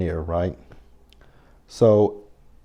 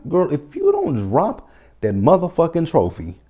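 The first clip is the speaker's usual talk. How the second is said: exasperated sassy yell; 8 semitones higher than usual. Real, not acted. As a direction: scold her like a fed-up friend. Yelling at Anna on screen.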